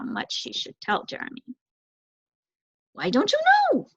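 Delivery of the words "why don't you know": high, fast, fretful bird voice; anxious young crow. Acted. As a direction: hurry like a nervous youngster. Crow eager question.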